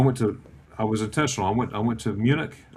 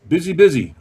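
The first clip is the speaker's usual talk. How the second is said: put-on Italian accent; 6 semitones higher, 8 dB louder than usual. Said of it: "He's Italian".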